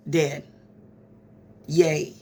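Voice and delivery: normal talking voice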